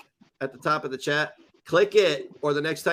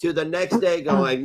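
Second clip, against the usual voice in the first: ordinary talk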